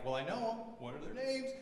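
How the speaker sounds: quiet, mock-searching thought; inner thought. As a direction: puzzle it out under your breath. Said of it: "I'm like".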